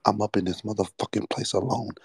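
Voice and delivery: usual speaking voice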